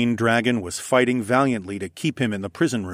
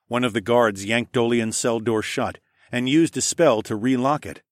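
usual speaking voice

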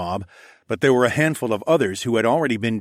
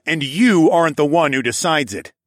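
loud, higher, firm rebuttal; heated arguing; 6 semitones higher, 7 dB louder than usual. A narrator's reading. Hero facing the polar bear, arguing firmly. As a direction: push back hard. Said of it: "I argued".

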